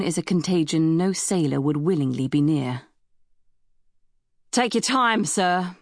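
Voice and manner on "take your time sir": mildly raised, higher-pitched call; understated shout from a ship's captain; captain's shout. A narrator's reading. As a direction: call out to someone at a distance. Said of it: "the captain shouted".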